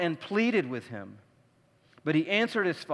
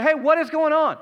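higher, louder indignant hey; 7 semitones higher, 5 dB louder than usual. Shown as a demonstration. Older son to the servant, indignant and louder. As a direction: demand it sharply, higher and louder. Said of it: "He's like".

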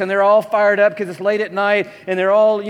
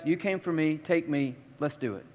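quiet surrender; 3 semitones lower, 9 dB quieter than usual. Pretend. Jesus calmly surrendering.

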